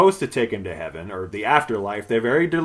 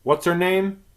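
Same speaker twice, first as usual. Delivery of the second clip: usual speaking voice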